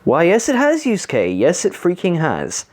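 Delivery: high-pitched mock agreement; dripping with fake cheer; sarcastic. Real, not acted. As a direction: gush in exaggerated, sky-high agreement. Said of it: "Yeah, I'm being sarcastic".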